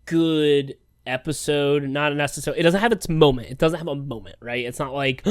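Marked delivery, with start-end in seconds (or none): none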